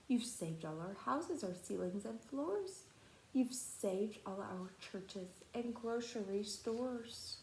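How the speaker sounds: soft, small grateful voice; tiny grateful Who. Acted. The tiny mayor thanks Horton, soft and grateful. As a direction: speak like someone very small and far away.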